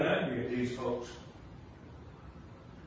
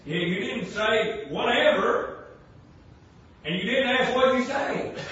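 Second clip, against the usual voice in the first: loud, higher, pounding preaching; full-force preaching; 7 semitones higher, 8 dB louder than usual. Acted.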